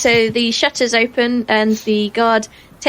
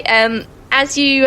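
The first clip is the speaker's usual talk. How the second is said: ordinary talk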